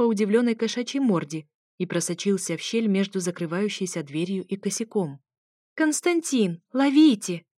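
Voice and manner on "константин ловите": high, louder call across the room; shout. A narrator's reading. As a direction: yell for him to catch it. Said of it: "выкрикнула я". (громкий высокий выкрик)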